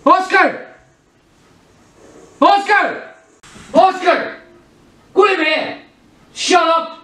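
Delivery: loud shouts at the dog; calling out. Real, not acted. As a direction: yell the dog's name loudly. Yelling at barking dog.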